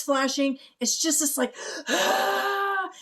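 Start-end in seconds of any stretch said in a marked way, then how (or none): 1.9-2.9 very high, loud mock scream; melting witch shriek. Imitating the Wicked Witch melting, a high mock shriek. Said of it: "when the Wicked Witch of the ... melts".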